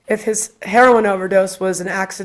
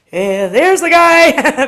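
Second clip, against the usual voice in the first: high, loud, drawn-out exclamation; excited sighting; 6 semitones higher, 6 dB louder, much slower than usual. Retold or imitated. Guide spots Zach Bagans on her tour, excited drawl. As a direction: blurt it slowly, like spotting someone famous.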